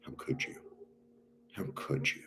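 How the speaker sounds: whispered, wounded question; hurt whisper. Retold or imitated. Resentment asking a friend, whispered and hurt. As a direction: breathe it out, betrayed. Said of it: "resentment is like".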